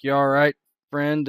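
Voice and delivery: loud, concerned check-in; concerned call. Acted. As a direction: call out to him with worry. GM voices concerned NPC loudly.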